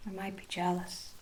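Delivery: ordinary talk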